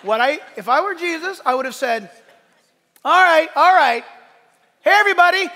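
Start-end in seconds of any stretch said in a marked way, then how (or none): 3.0-5.5 high, loud shout to a crowd; playful crowd-hushing call; calling for attention. Acted. Imagined Jesus calling crowd to attention.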